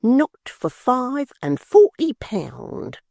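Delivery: high, slow spiteful maidservant; Miggs's voice. Acted. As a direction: drag it out, high and sneering. Miggs answers through the door.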